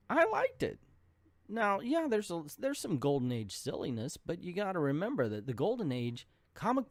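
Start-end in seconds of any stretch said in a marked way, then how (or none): 0.1-3.0 much higher, squeaky "I liked it". Pretend. Reviewer giving his verdict in a silly squeaky voice. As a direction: squeak it out playfully. Squeaky high comic opinion.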